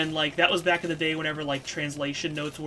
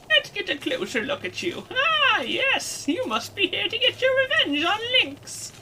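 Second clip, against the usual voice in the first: put-on very high, theatrical old-crone voice; knowing wizened crone; 5 dB louder than usual. Crone peering at an intruder, gleefully knowing. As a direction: gloat like a crone who has found you out.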